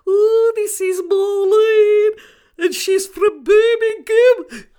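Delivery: very high, loud, drawn-out mock Brummie; exaggerated comic Brummie accent, slow and squealing; hammed-up Brummie introduction at the top of the voice; Beryl Reid. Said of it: "she used to pretend to be a Brummie".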